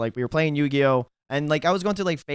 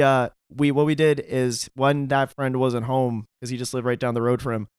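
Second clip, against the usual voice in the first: ordinary talk, nothing marked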